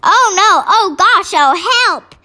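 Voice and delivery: high, loud gagging cries; a girl choking on a foul taste; spluttering in disgust. A narrator's reading. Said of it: "she spluttered".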